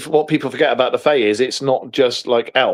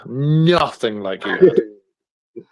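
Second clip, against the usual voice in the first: slow emphatic repeat; much slower than usual. Shown as a demonstration.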